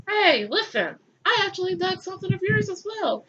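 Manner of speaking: high, sly teasing voice; hammed-up scheming; lightly sly Cain. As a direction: set up the punchline slyly. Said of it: "Cain is like".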